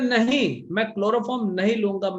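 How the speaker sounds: loud, high, stubborn no; defiant refusal. Retold or imitated. Stalin refusing the doctor's chloroform, loud defiant no. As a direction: bark the refusal like a man who won't be put under.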